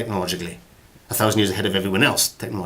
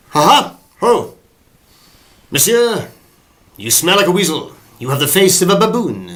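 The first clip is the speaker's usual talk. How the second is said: put-on loud, high laughing swordsman without accent; hearty musketeer, no French accent; swashbuckling musketeer; 9 semitones higher, 8 dB louder than usual. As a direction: boom it like Oliver Reed's musketeer. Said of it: "Oliver Reed didn't use a French accent".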